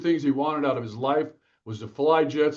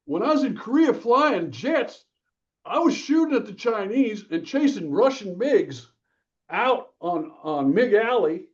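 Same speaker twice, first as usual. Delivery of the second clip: lightly voiced, higher old father reminiscing; a hint of an old fighter pilot dad remembering the war, pitched up; retold old dad; 8 semitones higher, 3 dB louder than usual. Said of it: "And he goes".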